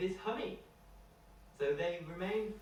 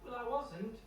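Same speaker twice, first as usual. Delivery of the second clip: put-on a much higher, childlike voice, puzzled; little boy Tom; 9 semitones higher than usual. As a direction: pitch up high to play the small boy. Tom denies to the bear.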